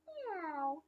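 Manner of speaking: high, drawn meow; mock cat meow. Cat answers the duck, high meow. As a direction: meow like a kitty.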